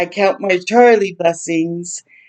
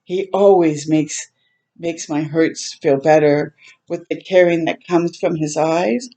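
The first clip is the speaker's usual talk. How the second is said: usual speaking voice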